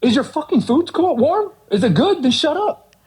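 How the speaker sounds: fed up with needy customers, snap back; exasperated retort. Retold or imitated.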